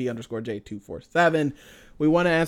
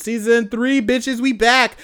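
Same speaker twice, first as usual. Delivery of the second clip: hyped; 7 semitones higher, 7 dB louder, slower than usual. Real, not acted. Hyped outro.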